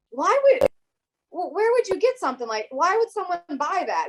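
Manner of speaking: high-pitched mock bewilderment; baffled principal. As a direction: imitate a boss who just can't get it. Imitates baffled principal reading her shirt.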